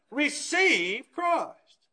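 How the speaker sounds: "receive Christ" drawn out very slowly; slow emphasis. Acted. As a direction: lay it down word by word. Preacher drives home point.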